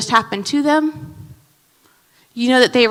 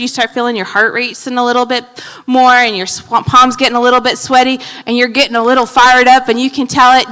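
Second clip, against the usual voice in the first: usual speaking voice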